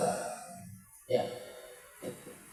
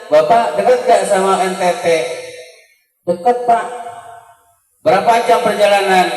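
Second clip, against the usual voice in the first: raised, louder acted-out question; retold questioning; 5 semitones higher, 5 dB louder than usual. Preacher re-enacting his questions to an NTT man, raised retold voice. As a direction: re-enact a lively exchange in a higher voice.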